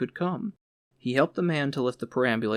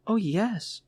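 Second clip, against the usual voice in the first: put-on lightly higher young woman's voice; a hint of an eager girl, voiced a little higher; voice of Fanny; 7 semitones higher than usual. A narrator's reading. Fanny answers brightly.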